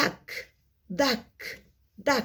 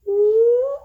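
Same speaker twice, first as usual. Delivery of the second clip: excited ooh; 13 semitones higher, 9 dB louder than usual. Acted. Excited reaction to the weather.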